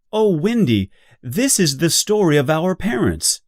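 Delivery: John bursts out, sure it is their story; excited. Acted. John tells Wendy excitedly about their parents.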